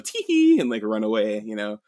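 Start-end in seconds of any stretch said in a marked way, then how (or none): 0.0-0.6 tee hee. Retold or imitated. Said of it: "they start scattering and going".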